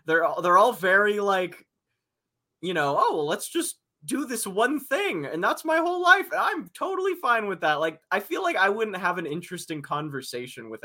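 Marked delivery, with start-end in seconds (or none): 3.0-7.8 high, sing-song mock of a dull local; mocking simpleton voice. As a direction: parody someone content with one thing. Mocking close-minded townsfolk.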